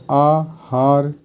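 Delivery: आहार stretched out slowly, each sound clear; slow clear demo. Shown as a demonstration. Teacher stretching आहार slowly so students hear it. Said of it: "आहार नहीं बल्कि आहार होता है".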